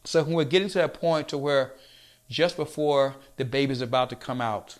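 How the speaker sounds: usual speaking voice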